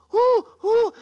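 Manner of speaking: high hooting owl who. Acted. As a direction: hoot it like a wise old owl. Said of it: "When the owl say".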